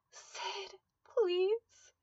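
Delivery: high, crying plea; tearful plea. A narrator's reading. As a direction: beg through tears. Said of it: "she pleaded".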